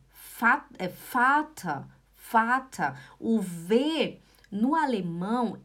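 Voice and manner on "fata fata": "fata" starting with a clear F; correct F sound. Shown as a demonstration. Teacher modelling Vater for students, clear F pronunciation. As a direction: model "fata" slowly, F for the German V. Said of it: "ele faz som de f".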